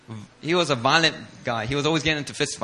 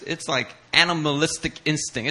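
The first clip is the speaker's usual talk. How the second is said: ordinary talk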